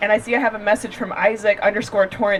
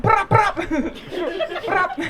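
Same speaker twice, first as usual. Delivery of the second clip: taser zap noises; 9 semitones higher, much slower than usual. Retold or imitated. Imitating the taser sound.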